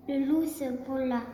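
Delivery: ordinary delivery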